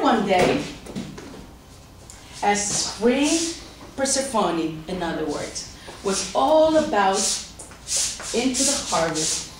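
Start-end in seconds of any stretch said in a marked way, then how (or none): none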